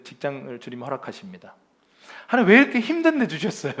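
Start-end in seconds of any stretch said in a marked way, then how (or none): 2.3-3.7 high, loud, rushed protest; play-acted grumbling outburst; mock complaint. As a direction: blurt it at God in comic exasperation. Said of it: "불평의 기준을 계속 옮기면서 불평해요".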